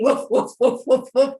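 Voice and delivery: loud imitated dog woofs; dog barking. A narrator's reading. Village dogs running toward the bear, loud barking. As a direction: bark in short, loud bursts. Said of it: "and barking".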